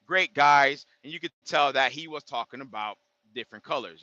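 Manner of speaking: usual speaking voice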